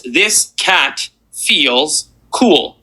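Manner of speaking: very slow, each word set apart; slow, deliberate mnemonic chant; slow formula recital. Shown as a demonstration. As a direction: spell out the formula slowly. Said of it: "the first formula you're going to use".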